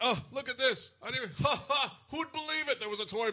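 put-on very high, delighted old celebrity; imitated excitement. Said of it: "He's excited".

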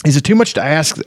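high-pitched mock exasperation; restrained storybook shriek of complaint; near shriek. A narrator's reading. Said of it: "practically a shriek".

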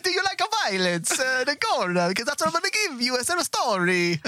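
very high cartoon Italian; mock Italian accent. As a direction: mimic a terrible Italian accent. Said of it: "what is that voice?".

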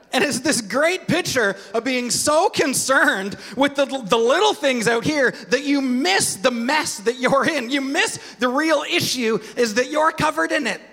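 usual speaking voice

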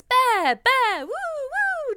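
put-on high sing-out to keep a baby awake; silly, high attention-grabbing noises; baby-entertaining voice. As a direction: call out toddler words, very high, swooping. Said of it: "saying all his words".

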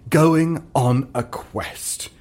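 usual speaking voice

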